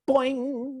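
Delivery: high springy boing effect; boing sound effect. Retold or imitated. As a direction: pop the spring noise up high. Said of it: "noise sound effects".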